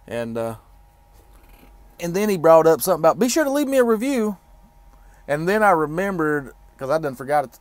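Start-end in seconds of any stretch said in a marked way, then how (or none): 3.2-4.4 high, louder put-on voice of the mechanic; imitating mechanic. Mechanic asks trucker for review, high mock voice. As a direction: mimic him asking cheerfully.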